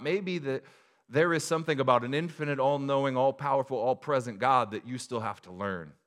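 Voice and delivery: loud, high, pointedly sarcastic preaching; hint of sarcasm. Real, not acted. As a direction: push it out forcefully with a sarcastic edge. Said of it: "There's a hint of sarcasm to that".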